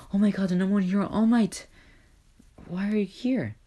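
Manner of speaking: high, excited fan squeal; starstruck crowd. Acted. Crowd thrilled at famous hero.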